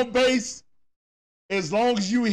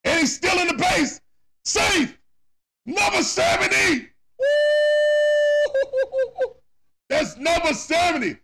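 excited shout; 8 semitones higher than usual. Real, not acted. Cheering a record steal.

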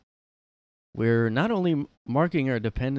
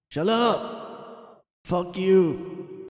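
high mock yell, much higher; mock Biden flipping out; 9 semitones higher, 3 dB louder, much slower than usual. Biden flips out at the reporter, mock angry yell. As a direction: snap back like a cranky old man. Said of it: "he, like, flips out".

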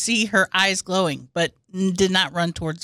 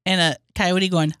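ordinary talk, nothing marked

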